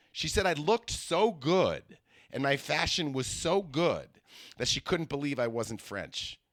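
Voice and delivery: ordinary delivery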